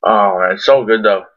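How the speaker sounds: usual speaking voice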